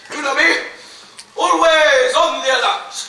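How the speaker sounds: proud loud boast. Acted. Tom boasting to Maggie that he is always watchful, said loudly. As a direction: announce your vigilance loudly.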